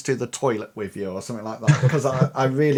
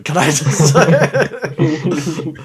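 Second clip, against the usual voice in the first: laughing; 7 dB louder, much slower than usual. Real, not acted. Laughing at constipation joke.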